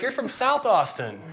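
high, drawn-out pretend question; mock incredulous guess. Stranger guessing where in Austin she's from, mock incredulous. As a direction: stretch it out as a puzzled stranger would.

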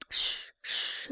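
soft voiceless shushing; hushing. A narrator's reading. O'Halloran calming a furious friend, hushing. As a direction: hush a man about to flare up. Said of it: "said O'Halloran, observing the violent expression".

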